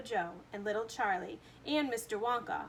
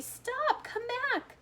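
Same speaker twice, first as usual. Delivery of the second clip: high, loud pleading scream; 9 semitones higher, 4 dB louder than usual. A narrator's reading. Mother begging her son to stop, high scream. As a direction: beg him to come back. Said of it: "screamed Missus T V".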